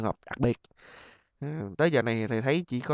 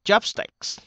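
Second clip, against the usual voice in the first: Chopsticks with CHOP high and short; stress on first syllable; 8 semitones higher than usual. Shown as a demonstration. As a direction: hit CHOP, lighten sticks in Chopsticks. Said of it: "D nhấn âm một nha". (nhấn âm một Chopsticks)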